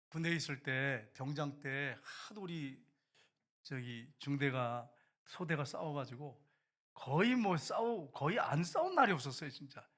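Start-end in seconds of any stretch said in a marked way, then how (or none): none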